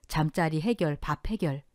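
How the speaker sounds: ordinary delivery, nothing marked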